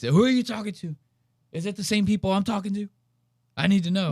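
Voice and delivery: high, jittery impression of the nervous homeowner; mocking the paranoid guy. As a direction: play him rattled and suspicious, up high. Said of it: "Now he's nervous".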